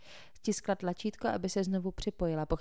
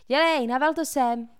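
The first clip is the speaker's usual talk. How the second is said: loud, higher yell at a freshman; shouted order; 7 semitones higher, 10 dB louder than usual. A narrator's reading. Janet yells at a scared freshman: loud order. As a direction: bark it out at him. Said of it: "křikla Janet".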